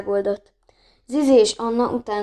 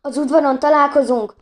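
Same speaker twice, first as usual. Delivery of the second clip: loud and higher, yelling to friends; shouted; 5 semitones higher, 6 dB louder than usual. A narrator's reading. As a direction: shout it over your shoulder while running. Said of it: "ordította Leó".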